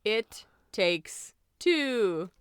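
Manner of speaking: halting announcement with long pauses; slow, drawn-out film reveal; suspenseful reveal. Real, not acted. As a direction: dole out the title bit by bit, stretching the last words.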